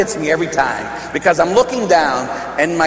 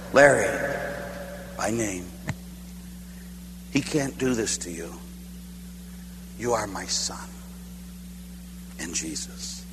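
gentle voice of God; 7 semitones lower, 12 dB quieter than usual. Retold or imitated. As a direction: speak tenderly to a frightened son. God speaking in the dream.

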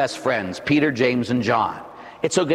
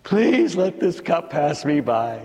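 begging; 3 semitones higher, slower than usual. Acted. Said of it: "He begs his father".

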